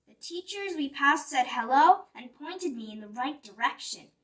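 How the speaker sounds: ordinary delivery, nothing marked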